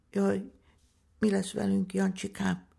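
put-on high, plaintive child's wail; frightened sister fretting in a high voice; tearful little girl. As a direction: voice a little girl close to tears. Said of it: "sírta el magát Juliska".

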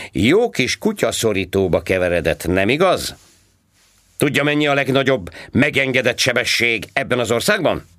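high, loud mocking cop voice; sarcastic policeman. A narrator's reading. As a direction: needle him like a smug traffic cop. Policeman interrogating. (gúnyos rendőrhang, magasan és hangosan)

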